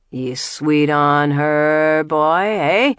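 loud, slow, drawn-out jeer; mocking taunt. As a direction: drag out sweet to needle him. [name] taunts Boutros.

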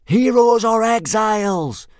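high, slow chant-like shout; loud fanatical echo of a war cry; fanatical yell. A narrator's reading. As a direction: yell it back like fervent boys. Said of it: "yelled eighteen boys fanatically back at him".

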